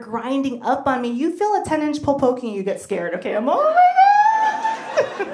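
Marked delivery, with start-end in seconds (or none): 3.5-5.3 high, loud mock gasp of shock. Surprised on the dance floor, hammed-up shriek. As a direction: squeal it in fake alarm.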